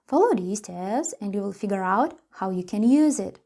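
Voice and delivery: ordinary delivery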